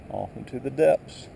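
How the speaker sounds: ordinary talk, nothing marked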